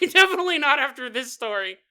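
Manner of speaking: laughing loudly as the line repeats. Real, not acted. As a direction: crack up while saying it again.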